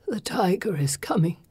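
drowsy whispered warning. Acted. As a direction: breathe it as he drifts off. Drugged father drifting to sleep.

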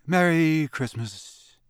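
slow, dreamy, far-away voice; vague dazed farewell. A narrator's reading. Mister Roberts waving them off, slow and vague. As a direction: drift off absently. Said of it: "waved them off with a vague".

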